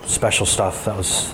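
ordinary talk

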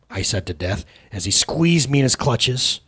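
ordinary delivery, nothing marked

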